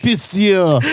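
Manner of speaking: hammed-up Russian cursing; caricatured Russian speech; mock Russian accent. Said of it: "the Russians, we talk like this".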